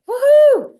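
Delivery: a high, loud woohoo of victory; excited cheer. Retold or imitated. A winner of the coaching contest cheers to herself, high and loud. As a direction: whoop it out like you just won. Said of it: "And I was so excited".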